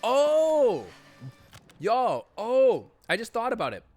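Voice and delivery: loud, high burst of realization; real excited outburst. Real, not acted. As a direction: shout out the sudden idea. Sudden realization exclaimed.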